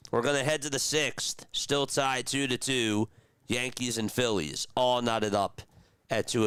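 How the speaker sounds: play-by-play call. Acted. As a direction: sell the line drive to the fans. Streamer calls the inning's end.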